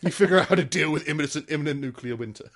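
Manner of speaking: fast, laughing through the words; laughing mock official. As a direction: rattle it off while laughing. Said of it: "Instead, it's just".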